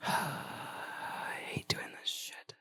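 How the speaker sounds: hushed, breathy aside; muttered grumble. Real, not acted. Annoyed at having to look it up, a whispered grumble. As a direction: grumble it under your breath, slowly. Grumbles while looking up the name.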